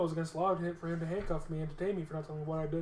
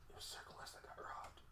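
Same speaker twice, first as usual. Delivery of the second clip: secret whisper passing on news; whispered tip-off; 21 dB quieter than usual. Retold or imitated. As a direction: whisper it so the cops won't hear. Friend passes on inside info.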